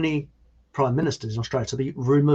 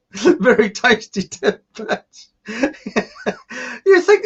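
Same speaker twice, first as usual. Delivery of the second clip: giggling through the words, higher and slow; can't hold the laugh back; 9 semitones higher, 5 dB louder, slower than usual. Real, not acted. Host cracking up at a viewer's dead bats joke, laughing.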